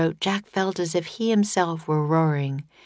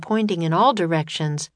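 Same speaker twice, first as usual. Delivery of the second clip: ordinary delivery, nothing marked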